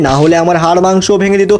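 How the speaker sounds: usual speaking voice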